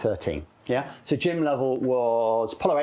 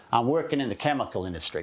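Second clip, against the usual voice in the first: put-on American voice; American accent. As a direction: imitate an American passenger. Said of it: "Do you like my American accent?".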